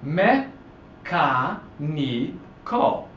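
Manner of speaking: me, ca, ni, co as separate beats; syllable by syllable. Shown as a demonstration. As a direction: break the word apart, pausing after each syllable. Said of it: "falls on the third".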